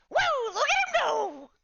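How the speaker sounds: very high, loud glitching squeal; glitchy creature squeal. Acted. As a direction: squeal it like a glitching little robot. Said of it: "And Calcumon says".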